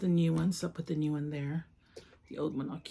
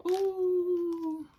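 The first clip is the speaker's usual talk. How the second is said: excited ooh; 13 semitones higher than usual. Real, not acted. Opening a new figure.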